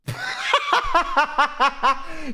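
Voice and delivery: high, rapid cackle; helpless high-pitched laughing fit; real burst of laughter. Real, not acted. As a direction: crack up in a long high laugh. Cracks up at twenty-buck confession.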